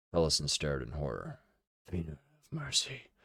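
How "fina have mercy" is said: softer, higher breathy prayer; breathed. A narrator's reading. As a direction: whisper it in horror. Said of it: "Heberick breathed".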